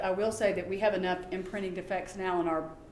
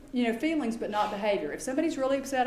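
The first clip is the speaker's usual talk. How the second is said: usual speaking voice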